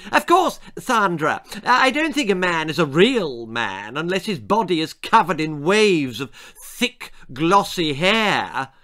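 preen as you lecture her, slowing at the end; haughty girl. Acted. Esmeralda lecturing the hairdresser.

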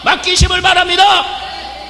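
힘껏 외치는 설교 호소; full-force preaching. Acted. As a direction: plead with the congregation at full voice.

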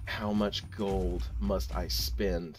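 slow, low dramatic question; mock-solemn lament. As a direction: intone it low and slow, hammed-up.

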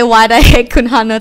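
mock exasperation. Shown as a demonstration. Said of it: "You say".

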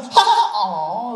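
mock laugh trailing into an aw. Pastor recalling watching the video with his wife, laughing. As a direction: laugh then sigh it off like a bad joke. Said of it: "I was like".

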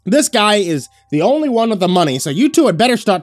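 loud, high-pitched angry demand; heated retort. A narrator's reading. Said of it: "Desmond replied hotly".

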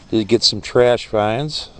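usual speaking voice

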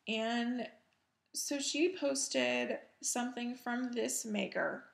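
ordinary talk, nothing marked

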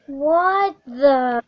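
usual speaking voice

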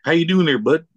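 hammed-up Canadian accent. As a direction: play a chummy Canadian saying hello. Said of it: "extremely Canadian".

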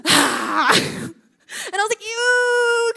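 high, loud revolted "ew"; hammed-up grossed-out scream, high and loud; disgusted shriek. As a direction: recoil from the stench with a yelp. Disgust at the creature.

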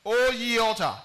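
loud, high prophetic cry. Acted. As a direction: call out to the altar. Prophet crying out to altar.

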